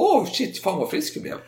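mock overjoyed; mock sudden recovery. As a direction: play the miraculous cure with glee. François suddenly cured.

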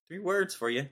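ordinary delivery

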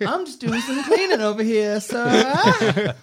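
high, slow Southern maid voice; hammed-up Southern accent. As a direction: put on a high, drawn-out Southern drawl. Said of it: "I don't know why I'm Southern either".

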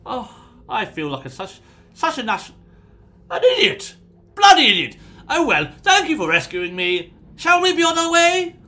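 high, loud, self-mocking laugh; flustered nobleman. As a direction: berate yourself theatrically. Prisoner realizes door was unlocked.

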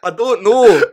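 put-on a feeble stab at a Scottish accent. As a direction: try a Scottish accent, high and loud. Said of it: "it's a hard accent".

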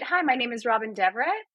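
soft slate rising into a question on "Deverett"; upspeak slate. Shown as a demonstration. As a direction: introduce yourself as if asking, lifting "Deverett". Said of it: "even with slating".

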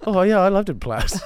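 laughing mock gush. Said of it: "mock enthusiasm laughing".

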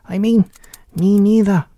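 high, loud little squeak; tiny mouse voice. A narrator's reading. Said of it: "that was the mouse there too".

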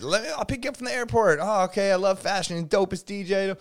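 bright high greeting; high friendly mimic. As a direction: chirp it like an excited host.

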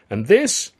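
high, loud build-up; presenting. A narrator's reading. Alf presents the comic, announcing grandly. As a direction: announce with a flourish, left hanging.

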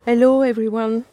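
ordinary talk, nothing marked

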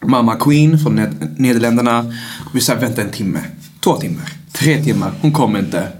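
ordinary delivery

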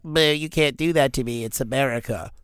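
mock blubbering protest; put-on voice of an indignant shopper; whiny objector. Imitating Walmart shoppers protesting the rule, whiny mock voice. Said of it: "they'd be like".

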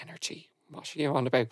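hushed, puzzled aside; baffled undertone. Acted. Bandmates reacting to his energy talk, quietly baffled. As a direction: drop to a quiet, bewildered mumble.

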